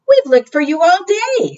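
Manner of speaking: high, relieved voicing of Papa; relieved father. A narrator's reading. Papa penguin telling Pip they searched all day, high and relieved. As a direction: sound relieved to have found her. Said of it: "said Papa".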